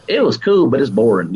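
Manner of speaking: put-on mom in Alabama accent. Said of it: "She's like".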